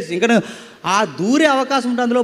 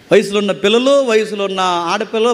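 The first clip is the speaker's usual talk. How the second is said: normal talking voice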